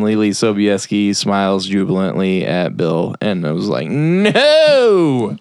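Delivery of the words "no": long, high wail of protest; horrified refusal. Retold or imitated. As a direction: draw it out high in mock horror. Teller recalls own horrified reaction.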